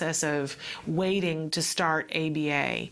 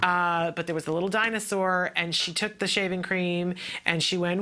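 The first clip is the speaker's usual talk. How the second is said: normal talking voice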